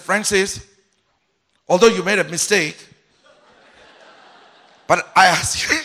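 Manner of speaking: rehearsed apology that comes out harsh; hard tone. Retold or imitated. Pastor rehearses apology to Francis, still in a hard loud tone. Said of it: "And your tone is out".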